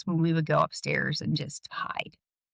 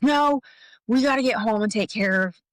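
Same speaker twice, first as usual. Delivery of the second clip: higher, louder opening on a retold refusal; touchy dad; 5 semitones higher, 4 dB louder than usual. Dad refusing the barbecue invitation, mock irritated. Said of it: "You, you hit a nerve".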